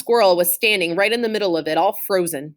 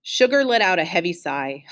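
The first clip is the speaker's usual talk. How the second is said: usual speaking voice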